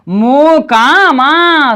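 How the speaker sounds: slow syllable-by-syllable Mokama; drawn-out reading. Shown as a demonstration. Teacher to child, reading Mokama slowly. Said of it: "तो ये क्या हो जाएगा".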